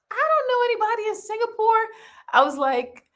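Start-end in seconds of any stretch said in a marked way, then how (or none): 0.1-1.9 much higher, astonished squeal; mock disbelief. Telling the host her shock at Singapore listeners. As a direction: sound amazed at the news.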